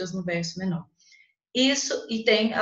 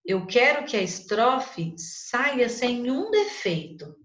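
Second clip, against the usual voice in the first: slow, stressing sem um defeito; teacher underlining the key phrase; emphatic paraphrase; 4 semitones higher, slower than usual. Shown as a demonstration. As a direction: lean on sem um defeito slowly. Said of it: "Olha aqui".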